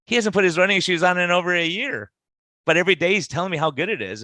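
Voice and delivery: imitated exasperated wife. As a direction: play the wife telling on her husband. Wife exposes husband amid laughter, retold.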